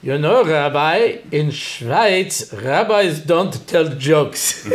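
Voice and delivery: put-on a slow, stiff Swiss accent; a Swiss host's accent, drawn out. A Swiss host scolds the rabbi in a slow Swiss accent. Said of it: "And one of them said".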